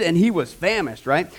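ordinary talk

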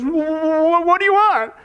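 very slow, quavering question, acted scared; scared stutter. Al to the old man at the window, mock scared. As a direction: drag it out as if scared out of your wits. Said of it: "he's scared out of his wits".

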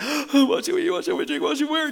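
fretful worrier. Pretend. Preacher mocks worried Christians, whining what should we eat. Mocking worriers fretting over needs.

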